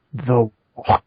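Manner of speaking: mock clipped British accent. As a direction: answer like a stiff British soldier. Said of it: "Why did he get a British accent".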